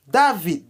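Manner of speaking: "David" as da-VEED, ending on d; Haitian pronunciation. Shown as a demonstration. Teacher modelling the right name, Haitian pronunciation. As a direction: say "David" with an open a and final d. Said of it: "não é David".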